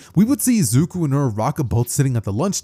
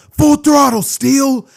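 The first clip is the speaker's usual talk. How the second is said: high, loud sustained yell; battle cry; 12 semitones higher, 8 dB louder, much slower than usual. Acted. Hero orders his partner to full power, battle cry. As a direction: shout it like a finishing move.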